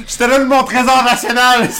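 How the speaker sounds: loud, high, hammed-up Québécois declaration; thick Québécois accent. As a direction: proclaim it like an overacting Québécois star. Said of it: "the thickest accent".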